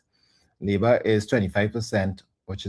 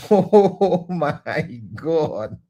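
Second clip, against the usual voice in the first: slow disbelief; 4 semitones higher, 4 dB louder, much slower than usual. Real, not acted. Reacting to an absurd question.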